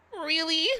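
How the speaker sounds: high, touched disbelief acted out; retold surprise. Retelling own reaction to friend's praise, acted surprise. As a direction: replay a high, questioning "really?".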